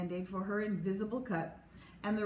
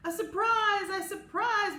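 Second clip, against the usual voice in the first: much higher, louder excited cheer; 12 semitones higher, 7 dB louder, slower than usual. A narrator's reading. The class cheers about the surprise, high excited. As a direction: kids bursting with excitement. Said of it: "we all cheered".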